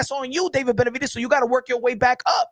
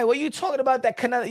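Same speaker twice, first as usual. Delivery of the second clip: normal talking voice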